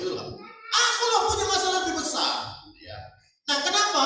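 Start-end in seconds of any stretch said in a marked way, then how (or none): 0.7-2.7 shouted, high, whining wife; complaining shout. Retold or imitated. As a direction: yell it like she's outdoing him. Istri membesar-besarkan masalahnya. (istri berteriak, pamer masalahnya lebih besar)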